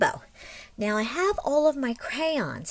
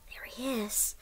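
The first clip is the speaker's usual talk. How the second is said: whisper; 11 dB quieter than usual. A narrator's reading. Teacher whispers to the children spotting the tambourine, hushed whisper. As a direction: breathe it out as a secret.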